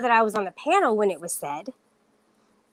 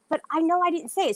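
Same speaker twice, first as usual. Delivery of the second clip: ordinary talk, nothing marked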